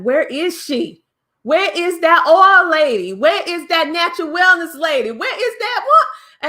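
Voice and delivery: voicing fans eagerly asking for her; mock calling out. Fans calling out for the oil lady, high and loud.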